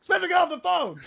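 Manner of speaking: high, loud angry shout; mock yell. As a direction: scream at a younger brother. Imitating brother yelling.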